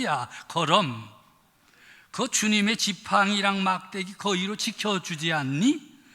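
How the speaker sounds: a lightly voiced Pyongan northern dialect, chatty and blunt. Pastor playing the Pyongan version of the psalm, in Pyongan dialect. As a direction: give the psalm a folksy Pyongan twang. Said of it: "평안도 버전은 어떻게 됐나?".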